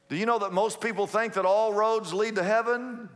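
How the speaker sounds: usual speaking voice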